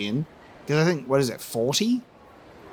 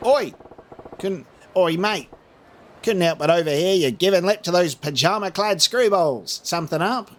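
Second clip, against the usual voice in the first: put-on higher-pitched, cliché Australian accent; 5 semitones higher than usual. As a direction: lay on a hammy Australian accent. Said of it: "very cliche and stereotypical Australian accent".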